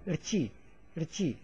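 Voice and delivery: "rchi" starting straight on r, no vowel before it; r-led syllable. Shown as a demonstration. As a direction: run the r directly into "chee" in "rchi". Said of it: "This part is read like".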